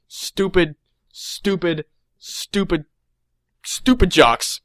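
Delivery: mock-bitter, each stupid set apart; bitter disdain. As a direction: brood on the insult, spacing every repeat. Bitter villain backstory, repeated insult.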